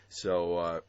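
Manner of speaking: ordinary talk, nothing marked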